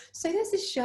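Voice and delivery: high, eager plea to her agent; excitedly pestering her agent about the show; eager pitch to agent. Retold or imitated. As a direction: pitch it up, all keen. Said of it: "I was like".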